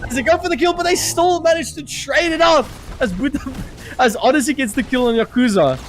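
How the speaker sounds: excited caster over the action; play-by-play casting. Acted. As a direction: call the fight as it unfolds. Live casting a team fight.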